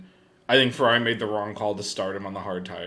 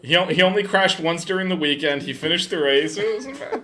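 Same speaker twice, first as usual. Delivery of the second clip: loud, high mock praise with laughter; sarcastic praise; 11 semitones higher, 10 dB louder than usual. Real, not acted. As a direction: oversell it with a grin.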